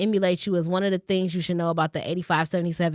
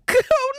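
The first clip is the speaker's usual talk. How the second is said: squealing laugh; high laugh. Real, not acted. Laughing at her own dismay.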